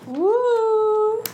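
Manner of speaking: high, loud woo; excited cheer. Real, not acted. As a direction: cheer the news. Cheering for the film.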